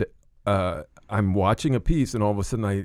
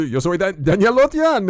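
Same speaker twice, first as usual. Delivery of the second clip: higher dubbed Spanish announcer voice; Spanish dubbing voice; 8 semitones higher, 4 dB louder than usual. Retold or imitated. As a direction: sign off like a Spanish dub. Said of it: "and you".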